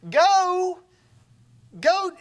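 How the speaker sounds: high, loud push; urging go. Retold or imitated. Family sending Jacob off, high and loud urging. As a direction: shove him out the door with it.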